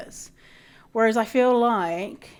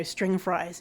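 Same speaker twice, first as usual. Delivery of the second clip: ordinary talk, nothing marked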